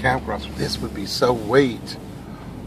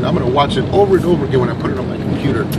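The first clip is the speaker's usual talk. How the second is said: ordinary talk, nothing marked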